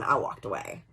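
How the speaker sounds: ordinary talk, nothing marked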